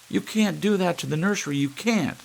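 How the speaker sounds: put-on high, loud, fast child's wail of protest; hysterical shout. Children screaming at their father to spare the nursery.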